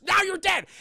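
high, loud sudden threat; spring the threat in a hammed-up yell; mock menacing shout. Fake paramedic turning on the guards, mock threatening shout.

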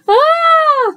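very high squealed "ah"; short high shriek; storybook scream. Acted. Kids don't share everything, one gives a high scream. Kids' contrasting reactions.

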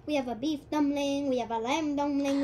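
restaurant order-taker with a put-on Chinese accent. Restaurant worker answering a customer, in a Chinese accent. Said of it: "the guy who's taking the order in the restaurant".

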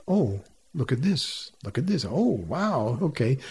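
lively, rising, full of wonder; inflected reading. Shown as a demonstration. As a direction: read it with wide-eyed inflection. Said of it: "or would you inflect".

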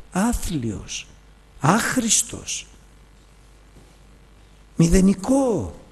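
high, slow self-condemnation; self-reproach. Retold or imitated. Preacher scolding himself before the Lord, slow and high. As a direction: spit out each word as a worthless sinner.

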